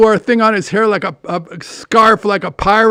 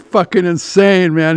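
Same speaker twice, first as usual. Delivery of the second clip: ordinary delivery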